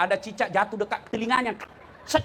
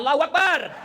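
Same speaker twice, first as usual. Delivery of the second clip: shouted from behind; 3 semitones higher, 4 dB louder than usual. Retold or imitated. Young man at the back cutting off the stuck imam, imitated shout. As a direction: call it out over the congregation. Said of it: "ada anak muda berteriak dari belakang".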